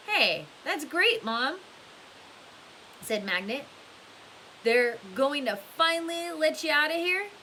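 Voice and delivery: drip sarcasm at the counselor; mocking sarcasm. Magnet mocking Mister Pendanski as Mom, high, loud sarcasm.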